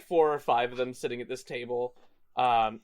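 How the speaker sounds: ordinary talk, nothing marked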